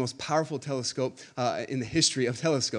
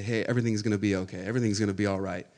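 quiet, lower comforting words to someone hurting; soft reassurance; 6 semitones lower, 3 dB quieter, faster than usual. Retold or imitated. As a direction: gently console a hurting friend. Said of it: "we'll say".

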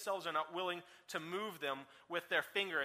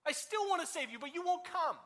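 higher, longing repeat; the same words again, pitched up and aching; pleading; 8 semitones higher than usual. Acted. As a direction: lift it higher the second time, pleading. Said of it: "he says".

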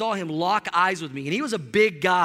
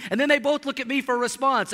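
ordinary delivery

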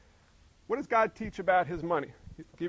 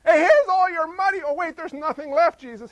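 sheepish believer; 9 semitones higher, 10 dB louder than usual. Acted. Believer handing Jesus empty money.

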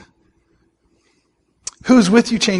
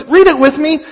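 ordinary talk, nothing marked

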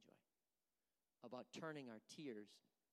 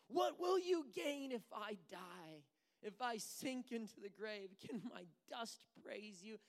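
high, loud, slow cry to God; preacher voicing David's anguished plea; pleading lament; 8 semitones higher, 7 dB louder, slower than usual. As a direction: plead with God as if near death.

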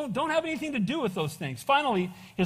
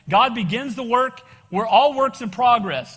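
emotional church testimony; 6 dB louder, slower than usual. Real, not acted. Emphatic preaching, slow and loud.

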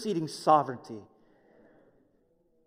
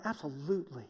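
usual speaking voice

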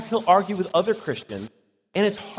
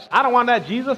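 higher, louder mock brush-off; mock refusal; 7 semitones higher, 5 dB louder than usual. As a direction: push the preacher away in disgust. Stranger rejects pushy evangelist.

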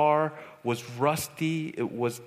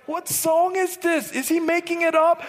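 high, incredulous questioning; baffled disbelief; 13 semitones higher, 5 dB louder than usual. Retold or imitated. Youth leader hearing off-key singing, baffled disbelief. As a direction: pitch it way up, utterly bewildered.

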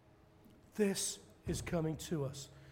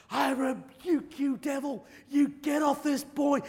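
slow, loud, high command; commanding rebuke; 9 semitones higher, 7 dB louder, slower than usual. Retold or imitated. Evangelist rebuking the devil over the boy, loud and slow. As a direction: cast the devil out.

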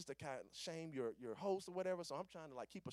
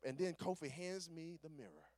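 usual speaking voice

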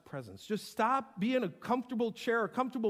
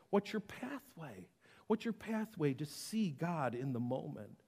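ordinary talk

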